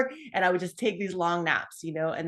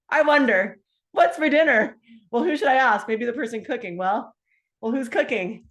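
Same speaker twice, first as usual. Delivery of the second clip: lightly voiced puzzled inner thoughts, higher; confused musing; 5 semitones higher, 6 dB louder than usual. Mocking her own foggy self at the stove, confused. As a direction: wonder aloud like you've lost the thread.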